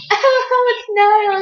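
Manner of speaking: high, loud retold squeal; hammed-up fangirl imitation; imitating sister. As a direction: squeal the name as an excited sister. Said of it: "Aaron was like".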